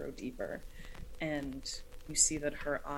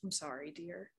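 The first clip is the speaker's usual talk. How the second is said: soft, quiet apology; lightly voiced old woman saying sorry; gentle apology; 8 dB quieter than usual. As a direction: comfort him softly. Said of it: "She looks at you and says".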